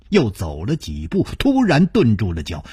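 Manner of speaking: plain reading voice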